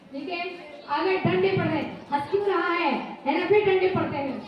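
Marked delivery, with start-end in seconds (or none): none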